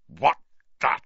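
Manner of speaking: furious, each word forced out separately; Uncle Vernon, gritted teeth. A narrator's reading. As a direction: seethe through clenched teeth, with loud bursts. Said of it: "said Uncle Vernon through gritted teeth".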